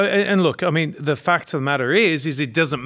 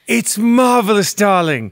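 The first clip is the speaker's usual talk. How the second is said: higher, louder, slow hammed-up theatrical gush; playfully overdone darling-voice compliment; mock luvvie praise; 5 semitones higher, 6 dB louder, slower than usual. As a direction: gush over it like a stage diva, tongue in cheek.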